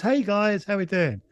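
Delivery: chirpy hello, pitched well up; upbeat. Retold or imitated. Upbeat Canadian friend bounding into the pub, cheerily greeting his mates. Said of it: "really upbeat".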